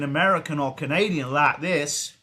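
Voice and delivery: mock Southern American voice; Southern accent. Shown as a demonstration. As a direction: slide into a hammed-up Southern US accent. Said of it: "That was my Southern accent".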